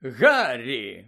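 loud hearty call of a name; loud acted exclamation; exclaiming. A narrator's reading. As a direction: call out to him in greeting. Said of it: "вигукнув він".

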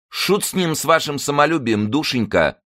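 very high, fussy womanish voice. A narrator's reading. Zaika brushes aside Karolina's pride, womanish. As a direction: cluck at someone like an old wife. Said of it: "голос его прозвучал совсем по бабье".

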